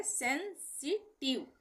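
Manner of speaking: सेंसिटिव broken into sen, si, tiv; syllable by syllable. Shown as a demonstration. Teacher splitting a long word for learners, सेंसिटिव syllable by syllable. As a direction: say सेंसिटिव in three separate pieces. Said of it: "अलग-अलग तोड़ के पढ़ा जा रहा है".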